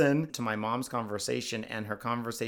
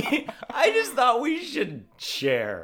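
laughing; 13 semitones higher, 4 dB louder than usual. Real, not acted. Giggling at the comment.

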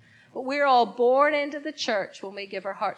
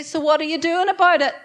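loud challenge; 6 semitones higher, 11 dB louder than usual. Shown as a demonstration. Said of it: "Turn to somebody else and say".

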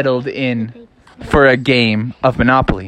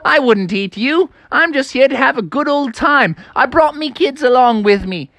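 high, jaunty character voice; 12 semitones higher than usual. Acted. Wolf reassures frogs he came to play, jolly. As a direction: reassure them with chummy good cheer.

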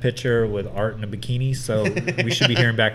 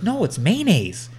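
put-on girlfriend quoted; 7 semitones higher, slower than usual. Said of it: "She goes".

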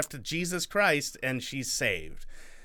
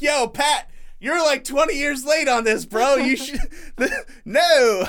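shouting high and wild; wild overacting; 13 semitones higher, 10 dB louder than usual. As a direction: yell it, laughing, overacting. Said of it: "And I'm like".

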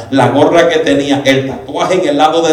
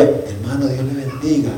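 hushed greeting; 7 dB quieter than usual. Retold or imitated. Preacher imitating himself on a lowered microphone, hushed.

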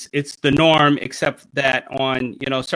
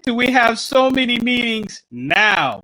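exasperated quote; 7 semitones higher than usual. Retold or imitated. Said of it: "It's like".

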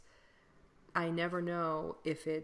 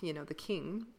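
normal talking voice

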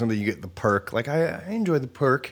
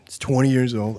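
normal talking voice

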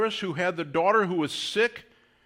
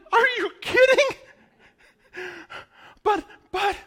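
squeaky high disbelief; are-you-kidding squeal of disbelief; mock astonished squeak; incredulous; 5 dB louder than usual. Real, not acted.

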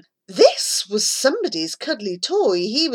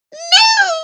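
very high loud hissed no; 7 dB louder than usual. A narrator's reading. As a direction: snap it under your breath. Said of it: "Charlie hissed".

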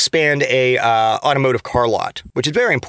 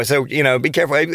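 normal talking voice

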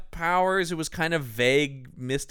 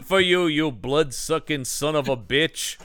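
tough vampire hunter; 2 semitones lower, 5 dB louder than usual. Retold or imitated. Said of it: "a vampire hunter thing where it's like".

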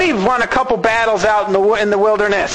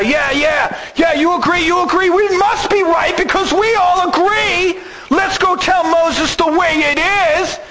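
high, eager shouting agreement; excited mob agreement; 7 semitones higher than usual. Acted. Mob egging each other on.

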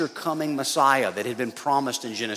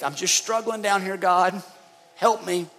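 humble plea; 6 semitones higher than usual. Pretend. Humble prayer example.

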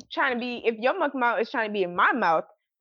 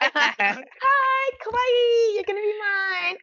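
put-on high, squealed kawaii girl voice; different, cutesy voice; 9 semitones higher, much slower than usual. Shown as a demonstration. Co-host demonstrates a nicer way to claim someone, high cutesy voice. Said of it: "you gotta do a different voice".

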